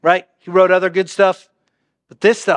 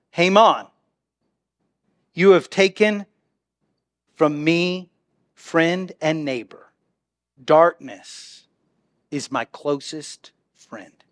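somber reading; 4 semitones lower, 4 dB quieter, slower than usual. Acted. Reading the psalm's bleak ending.

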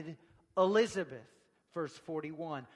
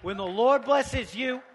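loud, raised preacher's voice; getting loud; 6 semitones higher, 11 dB louder than usual. Shown as a demonstration. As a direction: let it ring out over the room. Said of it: "it's okay to get loud".

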